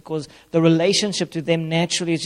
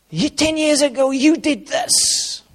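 accusing; 9 semitones higher, 3 dB louder, slower than usual. Retold or imitated. Acting out accusing old baggage.

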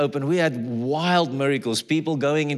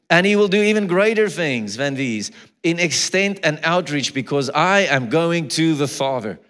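louder, higher preaching of scripture; preaching at full force; 4 semitones higher, 5 dB louder than usual. Acted. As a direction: proclaim the verse with rising force.

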